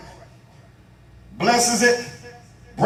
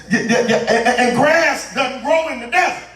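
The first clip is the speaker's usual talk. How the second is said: preacher's puzzled punch; 5 semitones higher than usual. Real, not acted. Preacher puzzles over grass in the desert, high exclamation.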